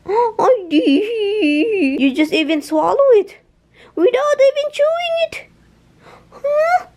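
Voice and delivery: squeaky high mock outrage; mock surprise to dog. Real, not acted. Teasing the greedy dog in a high squeak. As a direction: gasp and go way up, teasing.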